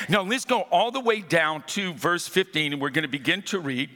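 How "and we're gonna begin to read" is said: ordinary talk, nothing marked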